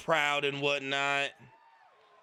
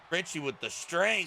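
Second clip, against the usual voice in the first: ordinary talk, nothing marked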